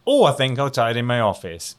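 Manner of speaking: ordinary delivery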